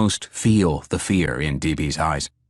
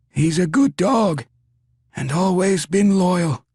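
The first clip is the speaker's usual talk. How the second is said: dying man voice; 8 semitones higher than usual. A narrator's reading. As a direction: say goodbye while bleeding out. Dying man's last words.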